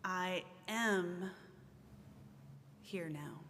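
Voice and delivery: "am" leaned on, with "here now" falling away softly; stress on am. Shown as a demonstration. Minister reading to congregation, stress on "am". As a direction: give the weight to "am". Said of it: "I will put the emphasis on a different word".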